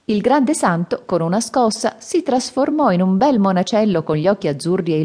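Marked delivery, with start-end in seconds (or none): none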